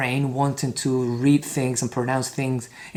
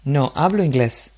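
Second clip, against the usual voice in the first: model dialogue reading; 3 dB louder than usual. Shown as a demonstration. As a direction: read each line out clearly for repetition. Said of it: "try to imitate my same intonation".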